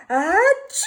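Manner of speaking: a loud sneeze breaking through. Acted. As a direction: sneeze it out hard. Gerald sneezes in story.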